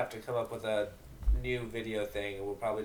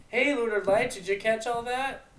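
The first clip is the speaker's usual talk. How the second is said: calling out; 11 semitones higher, 6 dB louder than usual. Acted. Pony calls to mare.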